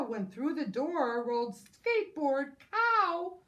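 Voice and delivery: ordinary delivery, nothing marked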